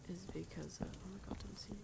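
very soft, nervous undertone; barely audible pleading; hushed dread. Real, not acted. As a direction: drop your voice so it won't notice you. Hiding from the monster.

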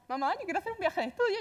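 súplica aguda y rápida de adolescente; adolescente suplicando. Retold or imitated. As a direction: pide el viaje con tono de niña pesada. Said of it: "Y en mi casa". (adolescente que suplica a su madre, voz aguda y rápida)

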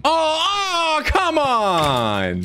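high, drawn-out cry of protest; frustrated wail. Real, not acted. Failed pearl attack on his buddy, frustrated wail.

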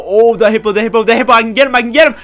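loud, high excited chatter; urgent excitement. Real, not acted. Gamer spotting a hippo he can get, urgent excitement. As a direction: repeat it in a rush of excitement. Spots a target in game.

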